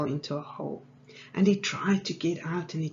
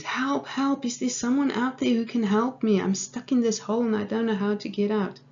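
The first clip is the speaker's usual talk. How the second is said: high, insistent mock cry for help; retold scream; 6 semitones higher, 4 dB louder than usual. Trapped man calls to a passer-by, mock screaming. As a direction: imitate calling out from down below, half screamed. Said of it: "he heard footsteps again and he screamed".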